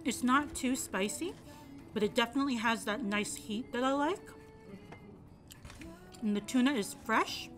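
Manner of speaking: usual speaking voice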